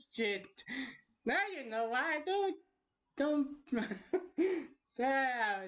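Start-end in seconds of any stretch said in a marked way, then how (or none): none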